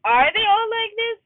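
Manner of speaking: high, loud exasperated question; exasperated disbelief. Real, not acted. Venting about cheating guys, exasperated. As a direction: wail it in disbelief.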